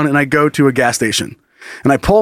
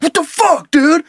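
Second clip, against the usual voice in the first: high-pitched, indignant mock yell; high, worked-up imitation of a yelling kid; imitated angry yell; 12 semitones higher, slower than usual. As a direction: yell it like an outraged skateboarder. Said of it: "what are you yelling about".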